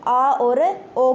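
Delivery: very slow 'आ' and 'ओ', each held long; contrasted vowels. Shown as a demonstration. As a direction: hold आ, then hold a rounded ओ. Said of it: "किस किस की?". (आ और ओ को बहुत खींचकर बोलें)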